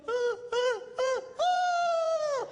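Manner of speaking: put-on high, loud little-kid squeals; toddler squeal. Grandsons find grandpa hiding, high excited squeals. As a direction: squeal like a thrilled two-year-old.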